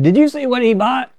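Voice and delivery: high, hushed busybody whisper-gossip; gossiping neighbor. Retold or imitated. Townsfolk gossiping at the grocery store, high nosy voice. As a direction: pitch it up like a nosy neighbor tutting.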